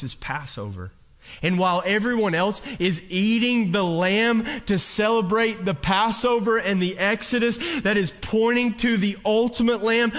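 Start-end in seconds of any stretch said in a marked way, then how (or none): 1.4-10.1 building louder and higher toward the lamb; rising preacher intensity. Real, not acted. Preacher building to a climax before the congregation. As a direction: swell the voice as you build the point.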